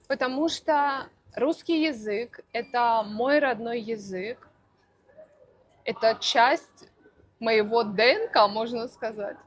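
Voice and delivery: ordinary delivery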